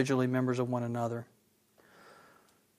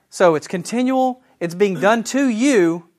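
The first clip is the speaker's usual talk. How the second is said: higher, louder, slowing on it's being done to you; building excitement; 5 semitones higher, 6 dB louder, slower than usual. Real, not acted. Teacher to class, thrilled by the grammar, higher and louder. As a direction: lean in as the point lands, pitch up and slow. Preacher building to the key point.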